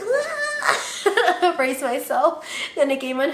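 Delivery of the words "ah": high squeal breaking into laughter; laughing squeal. Retold or imitated. Bracing for coworkers rushing out, laughing squeal. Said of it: "And I'm like".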